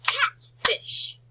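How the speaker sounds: CATFISH high and loud, a beat on CAT and on FISH; teacher chanting CAT-FISH to claps; clapped syllables. Shown as a demonstration. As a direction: punch out CATFISH in two beats. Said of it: "Let's clap that together".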